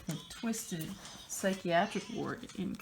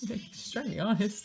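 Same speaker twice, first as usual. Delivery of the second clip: ordinary talk, nothing marked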